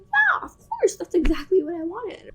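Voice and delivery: squeaky high, overeager yeah; mock eager agreement. To the stylist, faking she knows the word: overeager, high. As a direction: fake it like you knew all along. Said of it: "So I was like".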